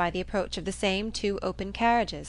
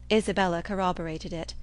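usual speaking voice

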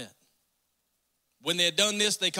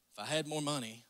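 soft, lower mock whine of if I had more money; mock complaint; 8 semitones lower, 9 dB quieter than usual. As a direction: grumble if I had more money under your breath. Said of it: "some of us are like".

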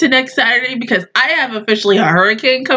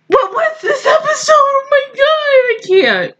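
high-pitched overwhelmed outcry; shocked wail; 11 semitones higher than usual. Real, not acted. Viewer reeling from the episode, shocked wail. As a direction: cry out high in disbelief.

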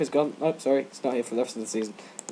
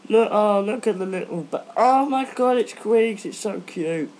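high, loud cooing over cute bats; mock gushing fan; 8 semitones higher, 5 dB louder than usual. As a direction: gush like someone smitten with the bats. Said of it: "It's like".

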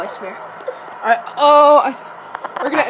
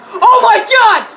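high, loud thrilled yells; excited shouting; 8 semitones higher, 4 dB louder, much slower than usual. Real, not acted. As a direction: cheer at the screen. Big punt return in game.

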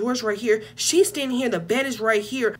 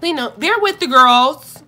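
playful plea; 5 semitones higher, 10 dB louder, slower than usual. Real, not acted.

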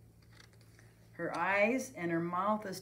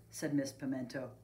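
usual speaking voice

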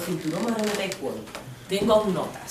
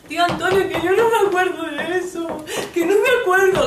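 high, loud tearful wail; hammed-up weeping protest; crying plea; 10 semitones higher, 6 dB louder than usual. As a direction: whine it through acted sobs. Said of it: "sigue llorando".

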